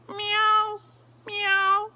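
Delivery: squeaky high meows, loud; high cat meow. Acted. Reading the barn story to a child, high meowing cat. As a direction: mew like a little cat.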